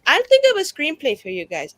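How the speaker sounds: eager offer. Acted. Eager character offers screenplay.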